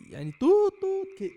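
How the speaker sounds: high mock frog toot; imitating a frog call, high and loud; playful frog noise imitation; frog sound. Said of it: "صوت الضفدع".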